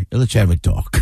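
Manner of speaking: low-pitched mock goomba menace; full-on goomba. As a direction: imitate a mob-type brother-in-law, voice dropped. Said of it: "full on, like".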